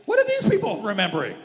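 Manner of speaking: high, loud disbelief; shocked bystanders voiced high and loud; incredulous Joshua and Caleb. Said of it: "Joshua and Caleb were like".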